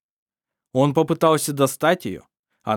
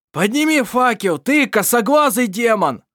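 furious shout, much higher and louder; angry yell; 10 semitones higher, 7 dB louder than usual. A narrator's reading. Irovar screaming at Mar to raise the torch, furious. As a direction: roar it at him in rage. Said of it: "заорал в гневе".

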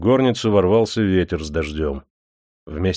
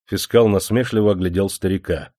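plain reading voice

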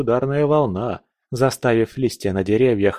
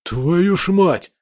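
slow, higher, stunned exhale; breathed out in shock; 6 semitones higher, much slower than usual. A narrator's reading. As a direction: gasp it out in disbelief. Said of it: "выдохнул наводчик". (ошеломлённый выдох)

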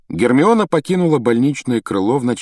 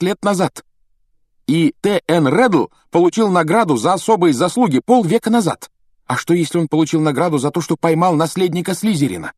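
high-pitched, eager schoolgirl reasoning; animated, raised voice building a case; Hermione, fervent and persuasive; 6 semitones higher than usual. A narrator's reading. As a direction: press the argument home with heat.

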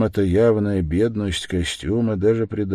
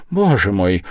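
exclamation; 3 semitones higher, 7 dB louder than usual. Acted. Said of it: "воскликнула Пульхерия Александровна".

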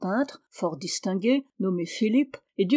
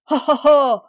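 loud, high, acted scornful laugh; spoken ha-ha-ha with frenzied disdain; disdainful laugh; 5 semitones higher, 8 dB louder than usual. A narrator's reading. Little man mocking the tall one, frenzied disdainful laughter. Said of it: "en riant dédaigneusement et avec une sorte".

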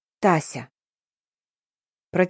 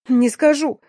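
high, mildly shrieked defiance; squealed refusal; 6 semitones higher, 3 dB louder, slower than usual. A narrator's reading. Girl refuses the boss's questioning, squealing. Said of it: "взвизгнула".

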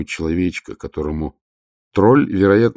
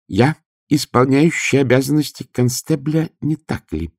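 high, earnest clerk voice; a nervous clerk insisting on his post; timid clerk voice; 9 semitones higher than usual. Acted.

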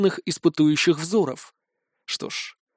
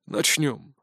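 dry, curt opening word; 3 semitones lower, 5 dB quieter than usual. Acted. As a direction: say it dryly. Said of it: "сухо начал председатель".

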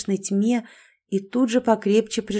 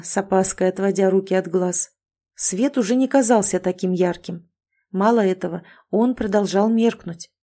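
ordinary delivery, nothing marked

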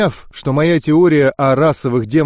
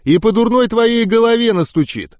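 irritated, high-pitched comeback; snappish retort; 8 semitones higher than usual. A narrator's reading. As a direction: snap back testily, pitched up. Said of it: "огрызнулся Оглоблин". (огрызаясь, раздражённо)

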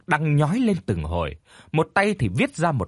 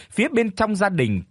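usual speaking voice

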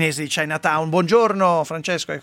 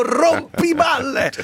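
drawn-out teasing; 10 semitones higher, much slower than usual. Acted. Host reads listener's teasing message.

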